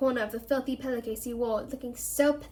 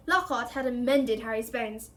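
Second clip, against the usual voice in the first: ordinary delivery, nothing marked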